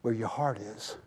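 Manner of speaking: low, soft and intimate; hushed reassuring voice of God; God speaking tenderly. Retold or imitated. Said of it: "He goes".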